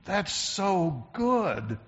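a lightly voiced, very slow murmur of delight over a tasty bite; savoring mutter. Preacher acting out tasting delicious food, slow murmured savoring. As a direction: linger on it like tasting something delicious. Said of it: "we start talking to ourselves".

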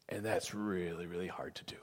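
drops to a hushed whisper. Real, not acted. Pastor confiding to his flock, in a whisper. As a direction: breathe it almost silently.

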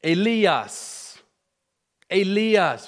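"Elias" said Greek-style, eh-LEE-as; Greek pronunciation. Shown as a demonstration. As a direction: stress the LEE in "Elias", loud and clear. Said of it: "in the Greek is pronounced".

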